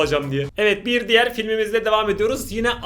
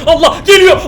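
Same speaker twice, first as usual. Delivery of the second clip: alarmed shout; 10 semitones higher, 13 dB louder than usual. Real, not acted. Shouting as scare comes.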